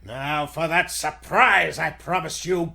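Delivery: ordinary talk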